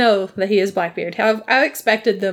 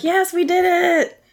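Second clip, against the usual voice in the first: excited cheer; 10 semitones higher than usual. Retold or imitated. Crew cheers escaping the Spanish.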